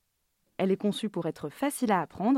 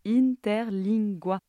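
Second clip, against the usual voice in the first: Interlingua said slowly and clearly, syllable by syllable; slow clear repeat; much slower than usual. Shown as a demonstration. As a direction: spell it out slowly for a confused listener. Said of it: "L'inter quoi?". (Interlingua, prononcé lentement et clairement)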